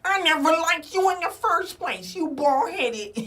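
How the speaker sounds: high, loud insult, slowing at the end; mock insult. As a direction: play the bitter rejected guy. Rejected guy insulting girl.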